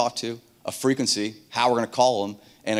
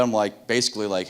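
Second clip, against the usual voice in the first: ordinary talk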